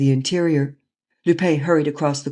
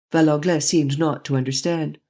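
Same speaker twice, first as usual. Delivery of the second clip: plain reading voice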